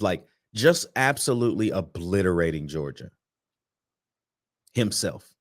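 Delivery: ordinary delivery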